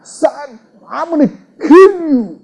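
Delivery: high, loud acted threat; hammed-up father's yell, pitch way up; angry father. Father threatening his son in exaggeration, acted angry shout. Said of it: "that father is angry with his son".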